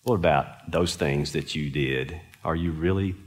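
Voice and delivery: low, soft questioning voice; a quiet, searching God prodding the conscience; gentle low voice of God, doubting; God's voice. Retold or imitated. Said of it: "God was saying".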